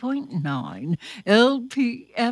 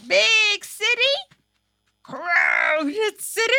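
lively city call; 10 semitones higher, 4 dB louder than usual. Acted. Acting out the busy city.

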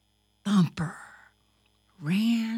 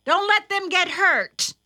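higher, louder motherly warning; instructing mother; 9 semitones higher, 5 dB louder than usual. A narrator's reading. Mother telling her son to protect his sisters, high and firm. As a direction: warn him in a raised voice. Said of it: "said his mother".

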